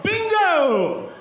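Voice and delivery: ordinary talk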